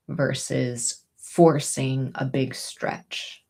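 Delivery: usual speaking voice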